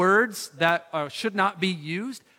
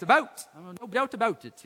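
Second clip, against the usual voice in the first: Canadian "about" said high and bright with the long Canadian vowel; mock Canadian "about"; 4 semitones higher than usual. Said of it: "if you're Canadian".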